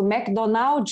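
McDonald's said Brazilian-style as "Mäc-Donaudschi"; Brazilian pronunciation. Retold or imitated. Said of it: "also brasilianisch ausgesprochen". (McDonald's brasilianisch ausgesprochen)

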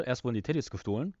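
ordinary talk

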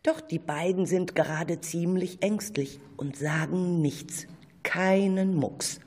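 normal talking voice

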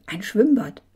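eager exclamation with a punch; excited call. A narrator's reading. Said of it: "rief er".

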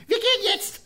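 very high, loud, piercing from above; shrill high woman's cry. Retold or imitated. Woman in the concert hall, calling out shrill and high. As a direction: shriek it down from way up high. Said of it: "Und ganz hoch, so ganz hell, ganz spitz, von oben runter".

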